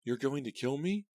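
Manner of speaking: high, disbelieving shriek. A narrator's reading. Girl facing the killer, shrieking. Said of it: "I shrieked".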